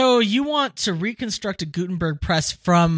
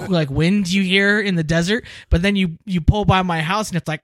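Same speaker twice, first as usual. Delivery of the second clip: ordinary talk, nothing marked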